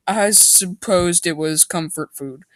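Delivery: usual speaking voice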